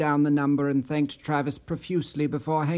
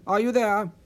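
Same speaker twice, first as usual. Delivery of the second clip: higher, anxious check; worried, raised question; concerned; 6 semitones higher than usual. A narrator's reading. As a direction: call down the line with concern. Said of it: "in a concerned tone".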